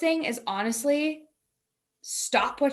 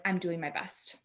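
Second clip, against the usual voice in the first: soft affirmation; 4 semitones lower, 7 dB quieter than usual. Shown as a demonstration. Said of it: "something very simple".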